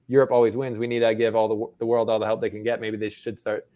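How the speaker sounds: usual speaking voice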